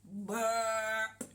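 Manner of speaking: a mock wolf burp; a lightly faked burp; satisfied burp. Shown as a demonstration. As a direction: burp it out contentedly. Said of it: "Have a go".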